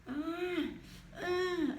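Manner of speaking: high strained ah, ah as if pushing out of a tight spot; squeezed struggle cry. Shown as a demonstration. Teacher acting the S squeezed between vowels, high strained cry. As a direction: act the squeezed letter pushing to get out. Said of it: "você tá no meio querendo sair".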